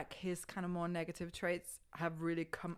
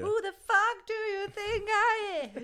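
high loud diva shout; sassy shout; 10 dB louder than usual. Pretend. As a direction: belt it like a diva on stage. Acting out Beachella performer attitude.

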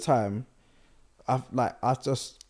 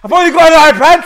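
laughing; 13 semitones higher, 18 dB louder, faster than usual. Pretend. Playing Ben at letterbox.

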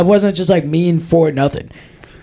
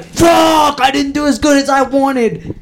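mock frustrated yell; 9 semitones higher than usual. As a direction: imitate a golfer cursing a bad shot, pitched high. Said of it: "He gets mad when he's like".

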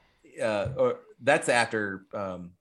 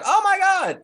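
very high, loud mock-terrified cry; mock scream; 8 dB louder than usual. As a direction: squeal as the startled kids. Retold kids scared by skeleton gag.

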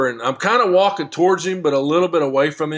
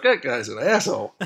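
said through laughter; laughing while imitating the guy; giggling out the line, a little softer; 3 dB quieter than usual.

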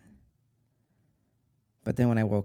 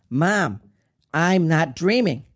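loud, higher desperate cry; screamed plea; 5 semitones higher, 6 dB louder, slower than usual. Retold or imitated. Son screaming to his dying mother, desperate. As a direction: cry out to stop her. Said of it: "I screamed".

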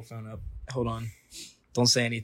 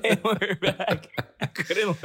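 high, slow, cracking up; barely holding in laughter; laughing; 9 semitones higher, much slower than usual. Real, not acted. Still laughing after the silence.

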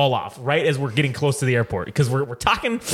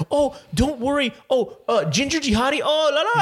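higher-pitched, lightly put-on woman's voice; fussing, higher voice of a delighted fan; lightly voiced fan; 9 semitones higher than usual. Voices delighted Indian lady carjack victim.

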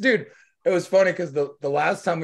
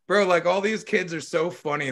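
ordinary talk, nothing marked

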